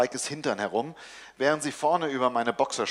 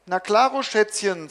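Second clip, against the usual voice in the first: higher, honeyed reply; wife answers her daughter sweetly, cooing; 6 semitones higher than usual. Acted. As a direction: coo it sweetly to cover up. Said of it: "säuselt Mareike".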